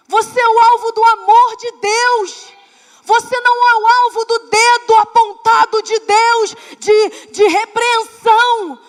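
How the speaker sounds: loud, high preaching at full force; emphatic preaching. Real, not acted. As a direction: proclaim it with fervor. Preacher building to emphatic declaration.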